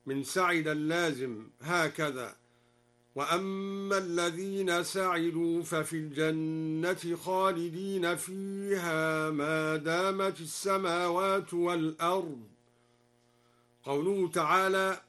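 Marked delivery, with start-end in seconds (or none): 3.1-12.4 slow chanted recitation, سعدوا opened with "sa", as "sa'idu"; drawn-out Quran recitation with سعدوا voiced "sa'idu"; fatha on the sin. Shown as a demonstration. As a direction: recite in measured tartil, saying سعدوا with a short a after the sin. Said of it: "قرأ الدوري بفتح السين مبنيا للمعلوم".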